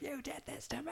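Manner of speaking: very high, squeezed mock squeal; mock outraged shriek. Retelling a kicked-out woman blaming the storyteller, mock outraged squeal. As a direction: squeal it softly, far above your own voice. Retelling an accusing outburst from a guest.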